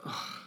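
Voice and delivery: soft, relieved exhale; imitated relieved sigh; sigh of relief. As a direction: let out a quiet sigh of relief. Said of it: "like, a sigh of".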